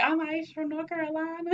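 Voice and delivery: teasing quote in a mock country accent. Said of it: "I had to keep going back".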